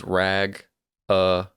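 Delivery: "Rag-a-ini" slowly, syllable by syllable; careful correct pronunciation. Shown as a demonstration. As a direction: break "Rag-a-ini" into slow, separated parts. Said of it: "the correct way to pronounce it".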